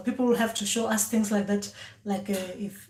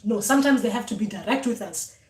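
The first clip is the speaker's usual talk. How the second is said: usual speaking voice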